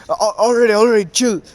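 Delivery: high and loud, hurried backing off; a startled giant toad quickly giving in; alarmed backing down. Retold or imitated. Gamabunta threatened by Naruto's chains, alarmed and backing down.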